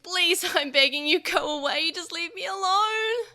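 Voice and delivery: high, loud, strained begging; desperate pleading. A narrator's reading. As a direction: beg breathlessly. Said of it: "Joe screamed".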